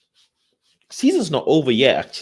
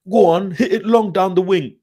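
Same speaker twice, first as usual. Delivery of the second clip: loud, high-pitched urging from the sidelines; old-school touchline shout; 9 semitones higher, 6 dB louder than usual. Retold or imitated. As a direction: yell it like an old-school football fan. Mimics old-school long-ball shouting.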